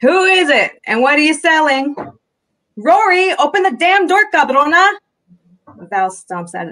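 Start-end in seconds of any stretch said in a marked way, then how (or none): 0.0-2.0 high, loud call through the door; teasing, pitched-up shout at the door. A narrator's reading. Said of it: "says loudly". 2.8-4.9 high, loud, angry sister; furious high shout at a dawdling sibling; exasperated yell. A narrator's reading. Exasperated sister yelling at Rory.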